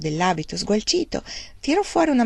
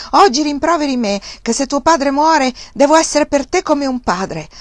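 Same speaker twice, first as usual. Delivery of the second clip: higher and loud; shrill furious rant; 7 semitones higher, 5 dB louder than usual. A narrator's reading. Uncle rants at nephew, shrill and furious. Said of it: "con voce stridula e tremante nella furia".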